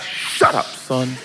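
whispered hush at the son; joking whispered rebuke to a child; mock whisper. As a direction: hiss it under your breath. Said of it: "I said".

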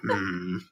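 low, quiet grumbling hum; Batman grumble. Acted. Acting out Batman's reply to Robin, grumbling. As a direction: grumble wordlessly under your breath.

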